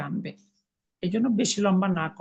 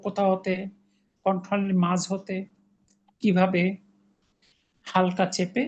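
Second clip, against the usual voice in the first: ordinary delivery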